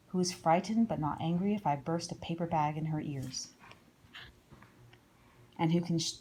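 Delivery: plain reading voice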